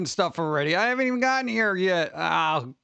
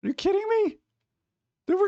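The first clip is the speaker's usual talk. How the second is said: outraged disbelief; 13 semitones higher than usual. Real, not acted. Chat mentions Christmas too early.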